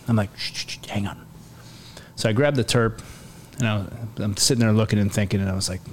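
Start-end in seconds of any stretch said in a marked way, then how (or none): 0.4-1.2 urgent hushed whisper; retold whispered hush to stay hidden. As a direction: shush them without a sound. Hushing teammate during raid.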